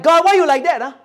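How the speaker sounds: whiny complaint. Retold or imitated. Young people complaining to God.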